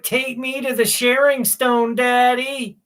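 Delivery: high, loud cute cat voice; voicing the cat. Owner voicing his cat begging to go, high cute voice. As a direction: speak for the pet as a pleading kid. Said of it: "He's like".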